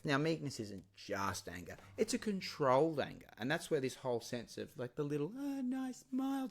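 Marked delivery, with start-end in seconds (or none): 5.4-6.5 high, soft, prissy voice; a falsely gentle, much higher voice; mock dainty. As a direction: mince it like something harmless. Mocking the soft image of meekness.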